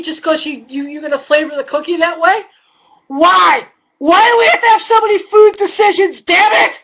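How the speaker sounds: ordinary delivery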